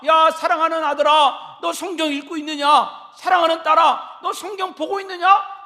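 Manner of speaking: loud fond call to son and daughter; calling out to a child. Pretend. A parent calling loudly to a son and daughter away from home. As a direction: call across the house, loud and loving. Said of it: "이거를 질문을 하라는 것입니다".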